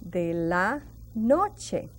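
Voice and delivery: de la noche said slowly, each word set apart; teacher modelling slowly for learners; slow model phrase. Shown as a demonstration. Teacher teaching evening time, slow and clear. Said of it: "we say".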